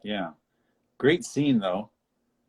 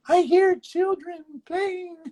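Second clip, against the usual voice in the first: put-on very high, very slow old lady voice; hammed-up granny wonder; mock old lady; 6 dB louder, slower than usual. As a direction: marvel like a dotty old woman. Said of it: "he's like".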